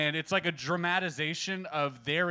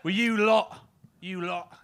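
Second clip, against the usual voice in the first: higher, slow fake Cockney indignation; Cockney accent; 3 semitones higher, 3 dB louder, slower than usual. Shown as a demonstration. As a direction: scold outsiders in hammed-up Cockney. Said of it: "like, a Cockney accent".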